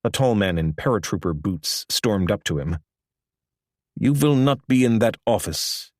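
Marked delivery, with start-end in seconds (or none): 4.0-5.9 put-on a light German accent, pitched a little higher; lightly voiced German accent. A narrator's reading. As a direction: read the hitman's line with a German accent. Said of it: "the man said in a German accent".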